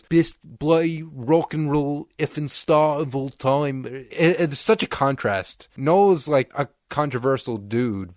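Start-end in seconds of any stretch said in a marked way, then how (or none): 0.0-3.9 slow, drawn-out Mancunian swagger. Retold or imitated. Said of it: "he goes".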